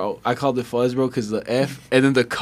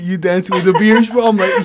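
ordinary talk, nothing marked

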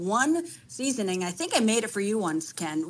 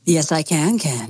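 lightly put-on late-night love-advice host, louder than usual; late-night radio host; 2 semitones lower, 7 dB louder than usual. Real, not acted. Caller answering the show's host, late-night radio host. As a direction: sound a little like a radio host, falling low on the name. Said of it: "you sound like a late night radio host giving love advice".

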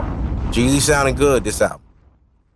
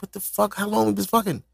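voicing the girlfriend; 7 semitones higher, 6 dB quieter than usual. Voicing his girl, high pitch.

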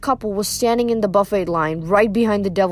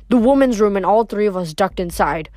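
usual speaking voice

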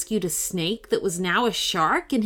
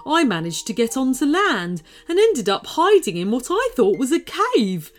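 higher, louder creature voice; a chatty sea creature explaining; shark storyteller; 5 semitones higher, 5 dB louder than usual. Acted. Shark telling Liam his curse, chatty creature voice.

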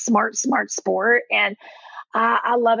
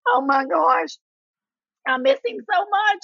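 high-pitched, hammed-up lament; mock bawling; 9 semitones higher than usual. Fan watching the replay misses Kobe, mock-tearful. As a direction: wail it lightly, imitating your own grief. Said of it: "I am bawling and wailing and gnashing".

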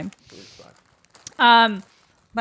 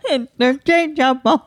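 toy robot voice; 4 semitones higher than usual. Pretend. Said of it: "I am a robot".

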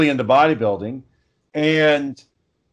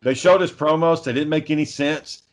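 ordinary delivery

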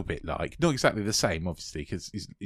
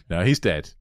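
ordinary talk, nothing marked

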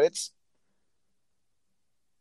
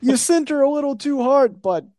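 higher-pitched, hammed-up Canadian accent; bad Canadian accent; 10 semitones higher than usual. Joking as a nice Canadian to co-host, bad Canadian accent. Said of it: "I can't do a Canadian accent".

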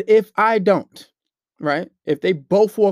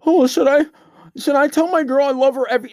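higher, louder whiny question; mock whiny follower; 7 semitones higher, 5 dB louder than usual. Mocking followers who message him, high whiny voice. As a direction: whine it like a needy fan. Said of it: "message me stupid shit".

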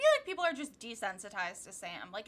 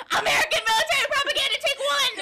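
high, fast, full-volume screech; hammed-up yelled declaration, pitched way up; mock announcement shout; 14 semitones higher, 11 dB louder, faster than usual. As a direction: shout it like a frantic film slate call. Announces her catchphrase after long pause.

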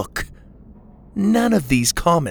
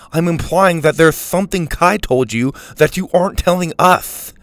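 accusing; 6 semitones higher, 5 dB louder than usual. A narrator's reading. Mac accuses Dylan.

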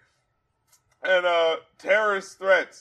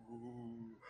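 breathy whispered background ooh; 8 semitones lower, 26 dB quieter than usual. Retold or imitated. Background voice in the track, whispered ooh. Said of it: "in the background, you just hear".

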